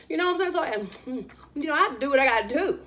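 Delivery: ordinary delivery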